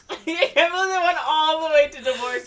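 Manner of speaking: high, loud, through laughter; laughing disbelief. Real, not acted. Friends react to the silly movie plot, laughing disbelief. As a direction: marvel at it while laughing.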